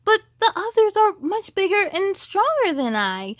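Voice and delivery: a hint of a shy, doubting Rudolph. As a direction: Rudolph doubting himself to Santa. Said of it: "Rudolph said".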